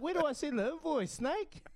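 joking demand, pitched well up; high teasing mock question. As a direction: tease him about billing for babysitting. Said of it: "And I was like".

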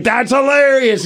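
high, drawn-out delighted exclamation; delighted reaction. Retold or imitated. Older fans getting the joke, delighted high exclamation.

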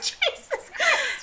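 high, slow laughing exclamation. Real, not acted. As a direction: burst out laughing through the words. Laughing at the story.

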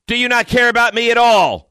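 high, loud accusing shout; imitated yell down. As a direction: yell it down the stairs, hammed up. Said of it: "I get a yell down".

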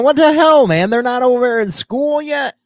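shocked and fed up; loud indignant surprise. Real, not acted. Shocked school still closed.

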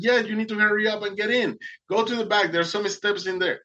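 high-pitched retold instructions; urgent urging. Boatman to swimmer at sinking boat, urgent urging.

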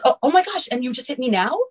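high-pitched imitation of staged shock; mock accusing. Voicing the ex's staged accusations.

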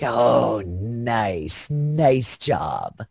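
low, unimpressed "nice, nice job"; mock impressed. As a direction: drop low and dry, deadpan praise. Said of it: "and I'm like".

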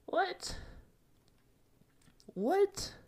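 very high squeaked disbelief; tiny falsetto bewilderment; incredulous. Retold or imitated. Acts out disbelief at cancelled plan.

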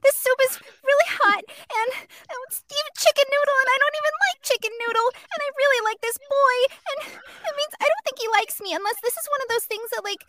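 put-on high, rambling teen-girl voice; fifteen-year-old girl. Girl confiding about the boy she likes, high teen voice. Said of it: "fifteen-year-old girls at some point".

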